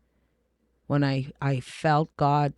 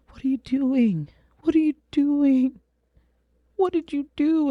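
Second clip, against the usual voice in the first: high, raised voice of horrified disbelief; reenacted anguished outcry; appalled self-reproach; 11 semitones higher, 5 dB louder than usual. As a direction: confront yourself, pitched way up. Confronting herself in retold horror.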